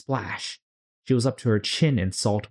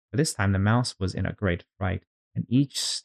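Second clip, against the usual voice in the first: usual speaking voice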